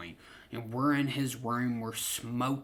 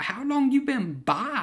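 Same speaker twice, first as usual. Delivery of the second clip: surprised; 12 semitones higher, 6 dB louder than usual. Retold or imitated. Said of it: "I was like".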